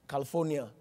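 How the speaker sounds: "California" said in full as "Cali-for-nia"; correct pronunciation. Shown as a demonstration. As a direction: sound the i near the end of "California". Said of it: "It's not California".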